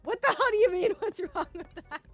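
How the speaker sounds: high, loud what the hell; incredulous squawk at a friend; defending a joke with a shrill-sounding disbelief; indignant outburst. Real, not acted.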